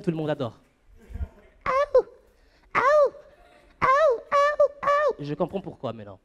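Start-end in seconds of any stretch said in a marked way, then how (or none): 1.7-5.2 very high, loud falsetto yelps. Retold or imitated. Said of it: "quand c'est [name]".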